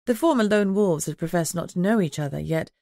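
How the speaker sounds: plain reading voice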